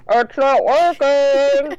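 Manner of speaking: loud, slow mock yell; imitated yelling. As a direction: yell it drawn out, as if through a tube. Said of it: "yelling in her ear through a tube".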